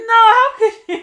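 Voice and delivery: high, loud laughing protest; playful shocked protest; mock outrage. Real, not acted. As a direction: cry out in playful disbelief.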